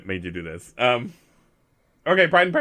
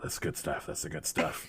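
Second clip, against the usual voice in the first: low, soft, breathy whispered rasp; raspy whisper; 10 semitones lower, 16 dB quieter than usual. Acted. Character enjoying his cigar, husky low whisper. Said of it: "and then I go".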